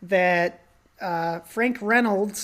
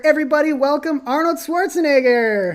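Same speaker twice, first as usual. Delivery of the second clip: loud, higher, quickening welcome; announcer introduction; 5 semitones higher, 4 dB louder than usual. Pretend. Host introducing a special guest, announcer-style. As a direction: build up to the star's name like a show host.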